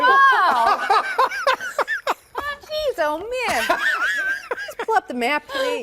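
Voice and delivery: high, giggling through the words; trying not to laugh, failing; anchor stifling giggles. Real, not acted. Anchor cracking up on air.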